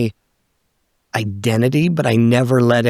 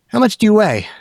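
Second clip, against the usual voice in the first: higher, lightly mocked gruff coach; coach's question; 9 semitones higher than usual. Wrestling coach asking the small kid his weight, higher mock coach voice. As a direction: toss it out like a blunt coach sizing him up.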